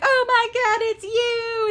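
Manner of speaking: high, loud squeal of joyful recognition; hammed-up delighted shriek; very happy. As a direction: act out an overjoyed old nurse. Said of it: "she's, like, very happy about it".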